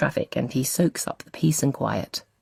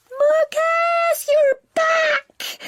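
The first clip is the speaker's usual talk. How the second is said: very high excited squeal; squealing little girl; 4 dB louder, much slower than usual. A narrator's reading. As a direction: squeal his name like a delighted little sister. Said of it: "Izzy squeals".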